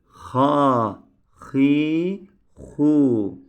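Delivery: "Ha", "Hi", "Hu" with a rasping throat h; teacher showing the throaty h, loud; raspy h. Shown as a demonstration. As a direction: scrape the h from the throat. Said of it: "Bu hırıltılı söylüyoruz".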